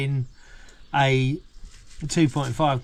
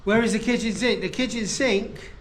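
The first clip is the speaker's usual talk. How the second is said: higher, teasing echo; playful excited; 7 semitones higher than usual. Real, not acted. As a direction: sing it out playfully.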